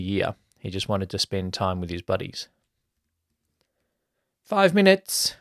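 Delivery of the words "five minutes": called out much higher and louder; loud announcement. A narrator's reading. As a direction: announce it to the whole room. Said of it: "Corinne announced".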